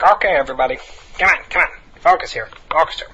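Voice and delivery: loud, bossy teacher voice calling the class to order; mimicking the sub teacher. Substitute teacher calling the class to attention, loud and bossy. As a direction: play the self-important substitute, loudly.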